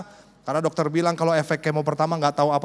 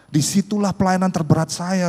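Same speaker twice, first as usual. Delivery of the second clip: ordinary talk, nothing marked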